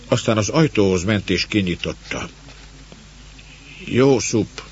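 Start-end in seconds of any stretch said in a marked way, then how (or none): 3.8-4.6 first, moderately raised call; higher-pitched, restrained call. A narrator's reading. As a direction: call the name into the night, not at full force. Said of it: "A kiáltás elveszett".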